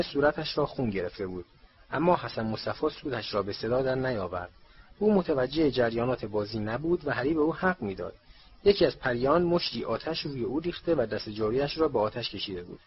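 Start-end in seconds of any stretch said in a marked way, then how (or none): none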